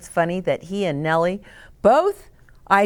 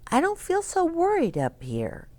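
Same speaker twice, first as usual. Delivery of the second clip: put-on higher, small girl's voice; Nellie's voice; 10 semitones higher, 4 dB quieter than usual. A narrator's reading. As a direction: voice Nellie as a shy little girl, pitched up. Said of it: "Nellie said".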